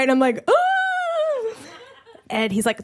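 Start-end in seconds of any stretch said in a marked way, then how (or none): 0.5-1.5 very high, drawn-out squeal; high-pitched comic unease; mock dread. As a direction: let out a long, high, uneasy note. Dread at being left alone.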